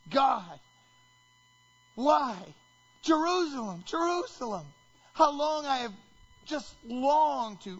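high, loud anguished cry. Acted. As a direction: wail to God in grief. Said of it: "he cries out loud".

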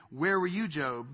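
ordinary talk

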